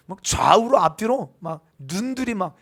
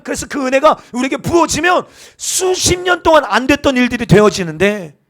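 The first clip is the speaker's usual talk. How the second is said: loud, higher impassioned preaching; fervent preaching; 6 semitones higher, 8 dB louder than usual. Acted. Pastor exhorting the congregation about grace, loud and higher. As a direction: preach it with rising fervor.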